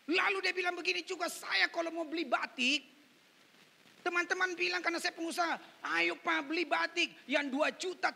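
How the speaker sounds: loud, high-pitched sermon anecdote; forceful pulpit storytelling; preaching. Acted.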